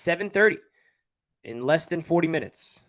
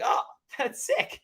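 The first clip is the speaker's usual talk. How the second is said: laughing reaction; 10 semitones higher, 4 dB quieter, slower than usual. Retold or imitated. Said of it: "I was like".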